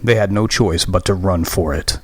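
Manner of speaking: ordinary delivery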